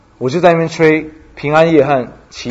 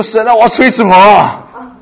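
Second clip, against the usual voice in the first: 慌张求助; 7 semitones higher, 4 dB louder than usual. Retold or imitated. 紧张地向神求助.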